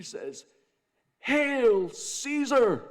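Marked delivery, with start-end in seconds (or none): 1.2-2.8 high, drawn-out crowd yell; pumped-up shout. Acted. Excited man in the crowd hailing Caesar, pumped-up shout. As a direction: holler it out at full volume, stretched long. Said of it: "he's all pumped up".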